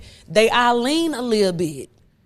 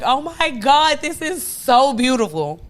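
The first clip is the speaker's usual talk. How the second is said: higher mock-moved exclamation; retold tearful gushing; 5 semitones higher than usual. Retelling crying at a touching dating show, mock tearful gushing. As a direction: gush as if moved to happy tears, lightly. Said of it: "And then I cried like".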